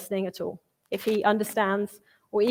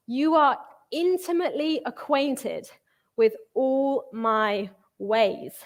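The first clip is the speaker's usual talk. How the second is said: slow, exposed reading; slower than usual. Acted. Preacher reads David's psalm aloud, slow and uneasy. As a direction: lift on intimately, then slow right down. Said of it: "angry and emotional and spiraling".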